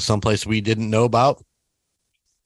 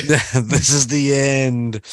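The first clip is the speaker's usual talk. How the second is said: slow, drawn-out mock last words; mock doom; 2 semitones higher, much slower than usual. Teasing the friend who nearly fell, slow mock doom. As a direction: stretch it out like a teasing death line.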